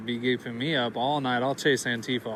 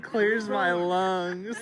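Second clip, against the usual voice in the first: slow quote broken by laughter; laughing; 3 semitones higher, much slower than usual. Retold or imitated. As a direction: repeat his line while cracking up. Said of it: "He said".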